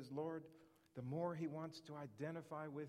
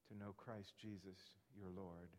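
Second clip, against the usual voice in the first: usual speaking voice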